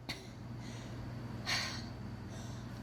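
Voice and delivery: long, soft exhale; long sigh. Real, not acted. Storyteller pausing mid-story, long soft sigh.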